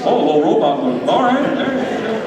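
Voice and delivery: ordinary talk, nothing marked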